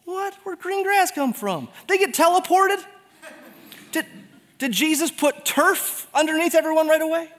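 high, incredulous, playful questions; mock astonishment. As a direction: play up the disbelief. Mock astonishment at green grass.

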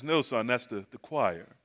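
low, soft fatherly answer; hushed, lightly voiced dad; patient father. Dad quietly answers his son in church, low and patient.

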